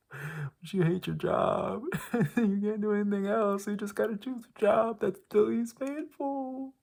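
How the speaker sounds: very high, strained squeal through helpless laughter; laughing despair. Real, not acted. Vlogger on being stuck in a hated job, hysterically despairing. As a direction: let the pitch climb, half laughing, half wailing.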